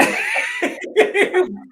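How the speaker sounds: high loud burst of laughter; big laugh. Real, not acted. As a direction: laugh out wide and free. Laughs at end of story.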